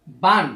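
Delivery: "বান" said as বা closed with an added n; tanween pronunciation. Shown as a demonstration. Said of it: "দন্ত ন যুক্ত করেন".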